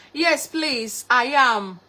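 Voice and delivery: ordinary talk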